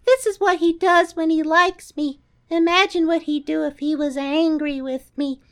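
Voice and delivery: high put-on voice for the quote; high character voice. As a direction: squeak it out in a high voice. Retold plant quote, high voice.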